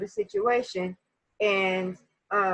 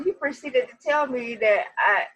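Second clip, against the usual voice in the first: ordinary talk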